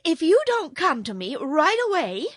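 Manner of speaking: high, loud, cross threat; getting provoked. A narrator's reading. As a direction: threaten as an exasperated girl. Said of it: "getting provoked".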